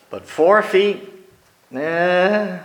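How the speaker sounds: normal talking voice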